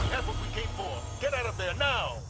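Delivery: high, urgent call to retreat; urgent order. Acted. Ally orders the fighter out of danger, urgently. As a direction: order them out in a high, pressing voice.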